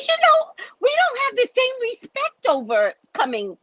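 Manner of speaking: imitating herself losing it at the office. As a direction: let the outrage break out in a cry.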